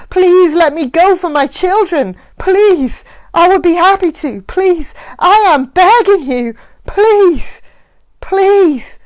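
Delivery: high, loud tearful begging; crying and begging. Acted. Mac at her feet, begging for his children. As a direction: sob out each please at her feet. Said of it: "crying and begging now".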